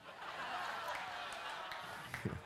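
soft airy laugh; breathy laugh. Real, not acted. Laughing at his own joke, breathy. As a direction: let out a quiet laugh.

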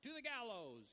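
hammed-up furious sentencing; mock angry verdict. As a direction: call for the gallows in fake fury. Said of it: "we just get mad and we're like".

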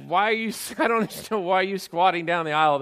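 retold bewilderment through a laugh; baffled dad. Dad to daughter squatting in the aisle, baffled.

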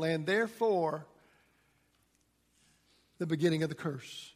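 ordinary talk

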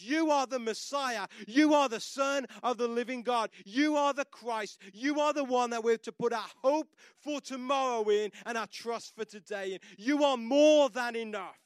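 high, loud proclamation of faith; fervent preaching. Acted. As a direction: proclaim each "You are" to the rafters. Peter's declaration, preached.

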